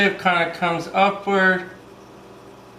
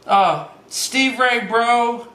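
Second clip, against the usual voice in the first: ordinary delivery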